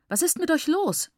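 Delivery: high, exasperated outburst; very angry. A narrator's reading. Teacher rebuking the whole group, very angry. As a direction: demand it, pitch leaping up in indignation. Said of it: "war über alle sehr verärgert".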